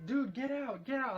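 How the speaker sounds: high, urgent mock yell; high-pitched retold yelling, not full volume. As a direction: order an intruder out, voice jumping up. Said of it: "like, yelling".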